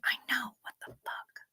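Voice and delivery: soft whispered aside, fading to near silence. Real, not acted. Streamer whispering to chat at 2 a.m., hushed. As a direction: whisper it off-mic. Muttering to chat late night.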